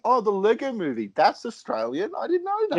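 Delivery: mock surprise. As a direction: play up being amazed at each film. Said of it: "we'd be like".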